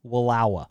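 "Wallowa" as wuh-LAH-wuh, softly; local pronunciation. Shown as a demonstration. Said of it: "I'm not gonna let you say it like that".

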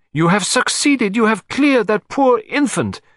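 high, slow, theatrical Russian countess; exultant cry. A narrator's reading. Countess hailing the detective's success, exultant. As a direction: exult grandly in a high accented voice. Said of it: "she cried".

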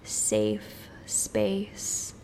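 hushed, soothing whisper. Acted. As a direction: whisper it like a secret. Whispered phrase in meditation.

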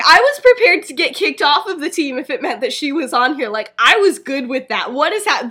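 loud, worked-up protest; yelling. Acted. Brady protesting Marina being left off, yelling. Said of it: "they're both yelling".

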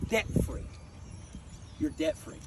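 hushed breathy whisper of mercy; a king softly whispering forgiveness; whispered pardon. Acted. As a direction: whisper it like a gift. King voiced in parable forgiving.

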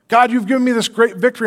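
preacher hams up Samson praising God, then turning; mock grateful Samson. Preacher voicing Samson thanking God, brisk and bright-voiced. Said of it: "I'm just gonna look around and go".

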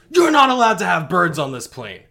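loud, high rule-enforcer yell; mock stern shout; scolding shout. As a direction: bark the rule at her like a flight cop. Man scolds her.

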